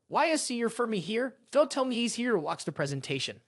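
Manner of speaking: ordinary delivery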